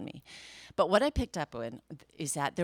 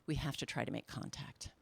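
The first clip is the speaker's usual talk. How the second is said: hushed agent; 8 semitones lower, 11 dB quieter than usual. Retold or imitated. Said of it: "He says".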